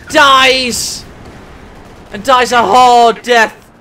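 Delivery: loud, drawn-out announcement of a kill; dramatic kill call. Real, not acted. Caster announces a tank dying hard, dramatic kill call. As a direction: punch it out, stretching the words.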